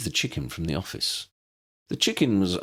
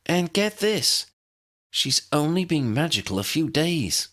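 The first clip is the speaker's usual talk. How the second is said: excited; 10 semitones higher, 3 dB louder than usual. A narrator's reading. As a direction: savour the big reveal. Excited news to her brother.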